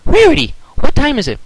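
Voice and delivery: high eager Twilight voice. A narrator's reading. Twilight asking Rarity the time, high eager voice. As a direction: ask brightly and high.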